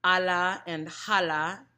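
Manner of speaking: hala said with a strong breathy H; breathy H contrast. Shown as a demonstration. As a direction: say hala with a loud aspirated H. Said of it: "The H sound".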